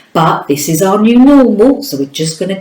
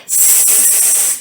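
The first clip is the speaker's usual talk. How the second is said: long voiceless snake hiss; soft hiss; hissed s sound; 10 dB quieter than usual. Shown as a demonstration. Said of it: "What's the first phoneme, the first sound?".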